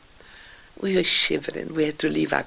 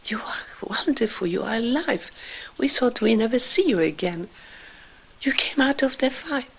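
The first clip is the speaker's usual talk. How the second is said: retold joyful shout; 7 semitones higher than usual. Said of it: "and they shouted".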